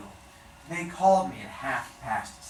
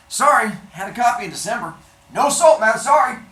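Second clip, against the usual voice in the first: quick, loud, higher brush-off; brusque clerk; 8 semitones higher, 10 dB louder, faster than usual. Acted. Clerk dismissing a caller about the book, fast and loud. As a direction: rattle off the apology and hang up.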